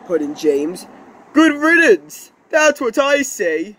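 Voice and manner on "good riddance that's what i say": high, punchy good-riddance tone; scornful dismissal. Acted. Engine glad to be rid of troublemakers, scornful. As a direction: dismiss them loudly and emphatically.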